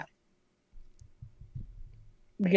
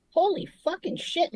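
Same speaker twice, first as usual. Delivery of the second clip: amazed swear; 10 semitones higher than usual. Retold or imitated. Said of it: "we're like".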